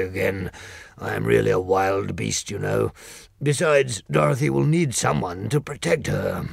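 declaring loyalty, a big beast; Lion voice. Acted.

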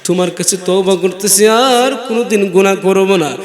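ordinary talk

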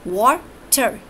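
"water" said in two separate beats; "water" syllable by syllable, with a gap; split into syllables. Shown as a demonstration. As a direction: break "water" after the first syllable, wa, then ter. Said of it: "wa is one syllable and ter is second".

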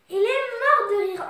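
"mort de rire" high and loud; emphatic. Real, not acted. Storyteller telling children he bursts out laughing, emphatic.

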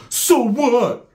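high shouted comeback; defiant retort. Retold or imitated. Handcuffed man turns to the cells, defiant shout. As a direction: throw it back at the hecklers.

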